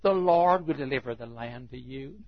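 mocking. Micaiah mocks the four hundred prophets, softly mocking. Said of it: "He mocked those prophets".

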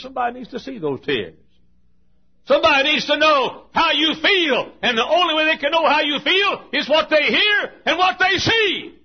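climactic preaching. Real, not acted. Preacher rising to a climax for the congregation. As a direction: lift it to a climax.

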